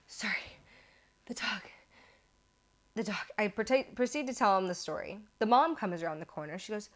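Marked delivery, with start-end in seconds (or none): 0.1-3.2 out of breath, soft broken phrases; mock wheezing. Wheezing rider apologising to homeowners, acted breathlessness. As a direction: gasp the words out between breaths. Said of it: "So I'm wheezing".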